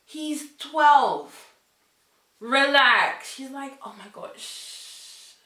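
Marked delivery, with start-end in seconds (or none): 0.0-3.3 loud, high-pitched incredulous outburst; exasperated retort. Retold or imitated. Telling her friend he's only twelve, exasperated. Acted retort to girl's crush. 3.8-5.3 slow, soft mock-drunk slur; imitating drunk. Imitating drunk friend shushing her about age, drunken. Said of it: "In a really drunken state".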